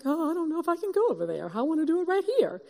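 nervously pleading to stay put; scared, hurried and high; fearful plea not to go over there. Retold or imitated. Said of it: "And I was like".

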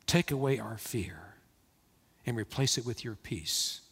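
hushed pastoral prayer with pauses between petitions. Real, not acted. Leads a prayer.

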